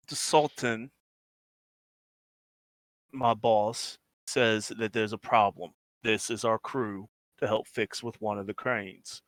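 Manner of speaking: lightly voiced henchman, low and soft; gruff underling reporting. Game master voices a crew boss's man.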